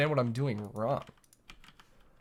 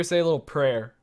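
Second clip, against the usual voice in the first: ordinary delivery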